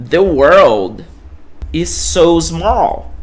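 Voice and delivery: The world is so small, very slowly spaced; careful slow model sentence; slow English demo. Shown as a demonstration. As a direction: read each word slowly for learners. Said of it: "deixa eu colocar aqui".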